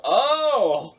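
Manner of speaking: sudden high loud oh of discovery; surprised oh. Retold or imitated. Writer realizing the twist, a loud surprised oh. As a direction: gasp out a surprised oh. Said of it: "and then you go".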